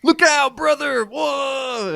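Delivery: high, hammed-up cry of warning; a brother's panicked shout, played for laughs; mock warning shout. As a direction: yell it like the lion is already leaping. Comic character warns of lion.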